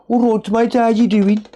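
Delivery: high Dudley accent greeting; Dudley "all right, mate". Shown as a demonstration. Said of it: "that's a, that's a Dudley accent".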